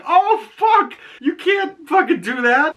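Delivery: reliving a gasp of disbelief; shocked outcry. Retold or imitated. Vlogger reacting to Augie's stabbing, high shocked cry. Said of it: "I was like".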